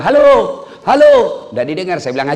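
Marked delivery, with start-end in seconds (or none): 0.0-1.1 loud, high hallo shouts; high, loud phone yelling. Retold or imitated. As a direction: holler down the line like an old man who has never used a phone. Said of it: "Teriaklah dia".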